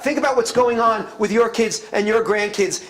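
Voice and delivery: ordinary talk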